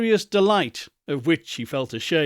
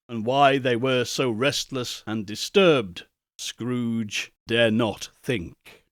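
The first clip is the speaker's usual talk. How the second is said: ordinary delivery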